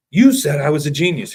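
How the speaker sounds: mock outrage, voice pushed up; indignant protest. Retelling a conned woman, indignant.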